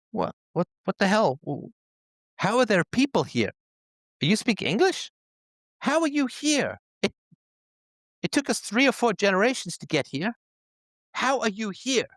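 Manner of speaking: high-pitched, incredulous space traveler; astonished. Acted. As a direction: blurt it out high, utterly baffled at who you've found. Acts out astonished arriving space travelers.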